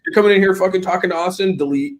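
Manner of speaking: high-pitched pretend telling-off; mock anger. Streamer jokingly scolds someone in the chat, mock angry.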